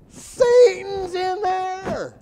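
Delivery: high, slow mock shriek of alarm; hammed-up panic, drawn out; a pastor fleeing in terror; imagined horrified yell.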